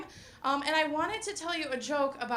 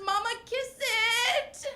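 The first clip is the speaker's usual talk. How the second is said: put-on high, slow whiny little boy; toddler voice; 10 semitones higher, slower than usual. Son asking mama for a kiss, high whiny toddler voice. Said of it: "he comes up to me and he says".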